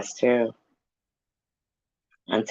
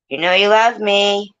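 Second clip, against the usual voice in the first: much higher mimicked sign-off; show catchphrase impression; 9 semitones higher, 4 dB louder than usual. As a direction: lift the pitch for the catchphrase. Quotes Gossip Girl sign-off.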